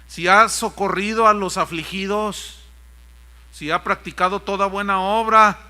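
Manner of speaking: loud, declaimed list; forceful pulpit reading voice; emphatic scripture reading. Acted. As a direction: proclaim each condition to the congregation. Reads scripture aloud.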